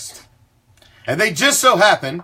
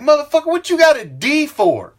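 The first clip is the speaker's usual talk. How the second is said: incredulous parent venting over a D; exasperated parent; 9 semitones higher than usual. Retold or imitated. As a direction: play the fed-up dad yelling at his kid. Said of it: "and you're like".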